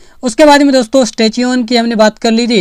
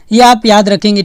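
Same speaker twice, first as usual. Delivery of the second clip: ordinary delivery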